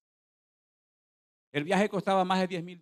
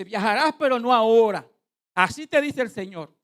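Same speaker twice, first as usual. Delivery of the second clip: loud, declaring prophet voice; prophet's pronouncement; 4 semitones higher, 9 dB louder than usual. Retold or imitated. Preacher re-enacts the prophet addressing him before everyone, prophetic proclamation. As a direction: proclaim it as a word from the Lord. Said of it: "me dice el Espíritu".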